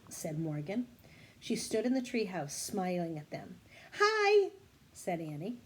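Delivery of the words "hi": high, loud cheerful greeting. Acted. Annie happily greeting Morgan back home, excited.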